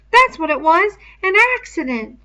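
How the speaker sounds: high, loud sudden blurt; Piggy blurting. A narrator's reading. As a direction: blurt it out before you think. Piggy insists.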